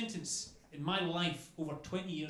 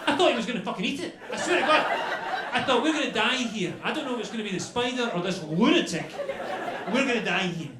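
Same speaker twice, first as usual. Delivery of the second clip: very loud, raised-pitch panic; panicked rant; 7 semitones higher, 13 dB louder than usual. Acted. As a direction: rant in comic terror. Retold terror at host and spider.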